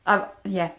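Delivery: choked up. Real, not acted. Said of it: "Now I'm getting really emotional".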